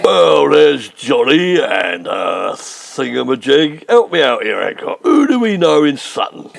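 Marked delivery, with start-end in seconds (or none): 0.0-3.9 slow, halting, groping for a name; stalling for names. Acted. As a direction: drag it out while bluffing. Stuck for names of Sutton friends.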